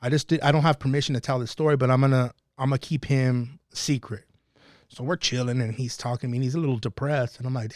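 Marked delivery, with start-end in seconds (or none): none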